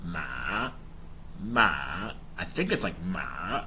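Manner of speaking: ma dipping low then back up. Shown as a demonstration. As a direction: let ma dip down and rise again. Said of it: "you have the dipping tone".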